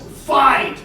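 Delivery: high call of fight; a schoolkid calling out a fight; mock yell. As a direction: shout it across the bus. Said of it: "somebody on the bus yells".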